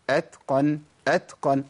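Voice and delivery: أتقن with ت released as plain air, no s; just run breath through the ت of أتقن; breath only. Shown as a demonstration. Teacher showing the correct way, أتقن with airy ت. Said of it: "أنا بمشي نفس بس".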